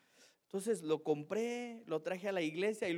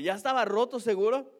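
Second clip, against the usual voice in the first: más fuerte y agudo, incrédula; la esposa no le cree, sube la voz; incredulous wife; 6 semitones higher, 10 dB louder than usual. Retold or imitated. Wife doubts his excuse. (esposa incrédula, pregunta fuerte y aguda)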